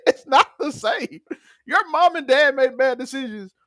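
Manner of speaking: high-pitched, drawn-out mock outrage; retold indignant accusation. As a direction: act out the aggrieved accusation, half laughing.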